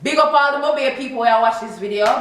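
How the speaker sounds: loud, lifted big-up to the fans; shout-out. Real, not acted. Vlogger giving a big-up to Mo Bay viewers, loud and upbeat. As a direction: call out to your hometown crowd.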